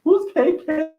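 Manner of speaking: high, loud mock puzzlement; confused who's K K. Streamer retells her confused question about K K to viewers.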